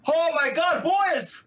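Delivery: excited Irish local. Retold or imitated. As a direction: call the boys over, star-struck. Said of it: "all of a sudden he goes".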